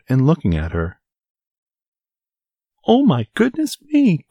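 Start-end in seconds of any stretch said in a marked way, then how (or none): 2.9-4.3 very high startled exclamation; startled cry. A narrator's reading. Goldilocks waking to three bears, startled high cry. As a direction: cry out as if woken with a fright. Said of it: "cried Goldilocks".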